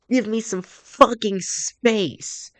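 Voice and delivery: high-pitched, drawn-out outburst; upset squeak. Acted. Tearful Natsuki pushes main character away, upset squeak. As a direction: squeak it out slowly, upset and near tears. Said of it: "Natsuki's voice came out as more of a squeak".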